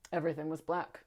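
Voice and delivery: ordinary delivery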